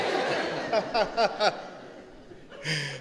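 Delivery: a real, hearty laugh. Real, not acted. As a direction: open laugh at his own story. Laughing at the story.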